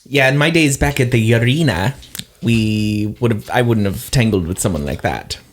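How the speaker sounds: normal talking voice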